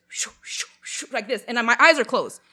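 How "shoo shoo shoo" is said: voiceless rushing whooshes; airy swirling sound effect; imitated vortex whoosh. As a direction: whoosh it out in separate bursts. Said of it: "it's going like this".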